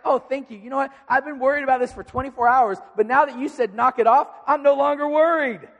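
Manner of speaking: loud, high, laughing sarcasm; over-the-top fake relief, ending in a laugh; sarcastic thanks. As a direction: ham up the gratitude until it cracks into laughter. Mocking thanks after "knock it off.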